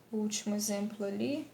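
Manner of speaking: ordinary delivery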